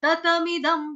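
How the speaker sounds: "ततमिदं" with both syllables of तत said fully; full ततमिदं. Shown as a demonstration. Teacher stressing the doubled तत for the student. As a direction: say "ततमिदं" clearly as त-त-मिदं. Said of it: "तत double तो है ना".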